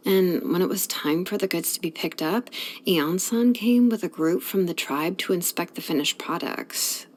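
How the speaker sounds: ordinary delivery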